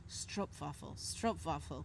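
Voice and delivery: put-on low, soft Dutch-accented murmur; little Dutch voice. Dutch woman correcting from behind, soft little Dutch-accented voice. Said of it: "I heard this little voice".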